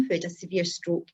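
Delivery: usual speaking voice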